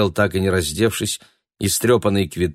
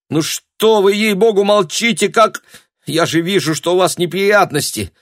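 high, loud, vexed exclamation; exasperated outburst; 12 semitones higher, 6 dB louder than usual. A narrator's reading. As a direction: cry out in exasperation. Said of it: "воскликнула она в сердцах".

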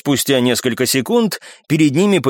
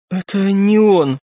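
slow, higher, tearful-leaning answer; hollow reply; 6 semitones higher, slower than usual. A narrator's reading. Girl correcting her friend, hollow and slow. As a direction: say it haltingly, grieving. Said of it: "глухо ответила девушка".

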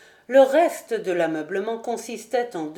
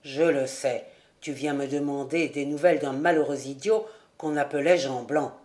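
put-on low, steady voice of a mysterious old charcoal burner; a lower, secretive host welcoming his guest; charcoal burner Rouan; 6 semitones lower than usual. A narrator's reading. Charcoal burner welcoming Jude into his hut, low and knowing.

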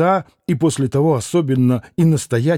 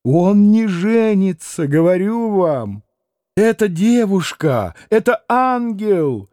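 higher, slower, ardent insistence; fervent exclaiming; 6 semitones higher than usual. A narrator's reading. Катерина Ивановна горячо защищает девушку перед Алёшей, с жаром и выше. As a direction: swear to it with burning passion. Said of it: "воскликнула вдруг с необыкновенным жаром".

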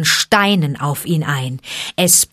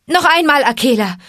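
higher, louder shout to the wolf; 8 semitones higher, 5 dB louder than usual. Acted. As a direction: call Akela across the herd. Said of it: "schrie er". (laut gerufen, höher)

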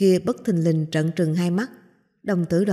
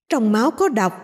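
inner shout; 3 semitones higher than usual. Acted. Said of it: "tiếng thét in tai".

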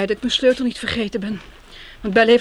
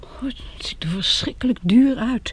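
awed whisper; slower than usual. Acted. Awed at the expensive flat.

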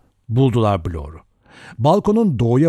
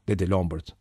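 plain reading voice

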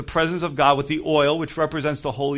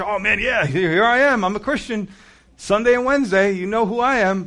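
higher, louder showy boasting; mock proud churchgoer; 6 semitones higher, 3 dB louder than usual. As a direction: ham up the Sunday believer.

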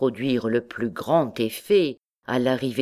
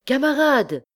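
high-pitched call to comrades; sudden cry; 10 semitones higher, 4 dB louder than usual. A narrator's reading. Young rascal at the window hailing the hall, crying out. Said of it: "cria tout à coup".

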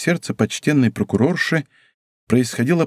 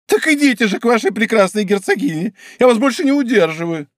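higher, proud dismissal; offended wife; 9 semitones higher, 3 dB louder than usual. Acted. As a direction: send him off in injured pride. Wife sending him away. (гордо обиженная жена, выше)

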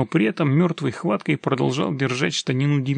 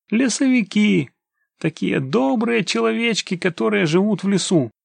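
high, playful storybook teasing; mocking; 7 semitones higher, 3 dB louder than usual. A narrator's reading. Demidov fobs off the stranger, teasing in a high voice. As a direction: explain it as if to a small child, tongue in cheek. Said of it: "усмехнулся Демидов".

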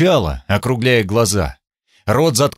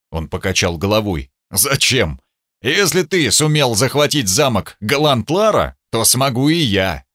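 ordinary delivery, nothing marked

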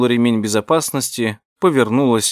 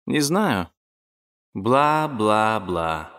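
dismissive drawl; 3 dB quieter, much slower than usual. Acted. Denika answers dismissively.

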